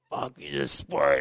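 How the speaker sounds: quiet, drawn-out resentment; bitter mutter. Retold or imitated. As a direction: spit it out slowly, fed up. Bitter after losing factory ride.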